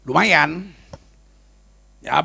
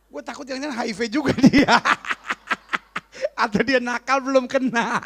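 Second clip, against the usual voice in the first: high voice breaking into laughter; real laughter through the joke; laughing; 7 semitones higher than usual. Real, not acted. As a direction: crack up while talking. Jokes and laughs through words.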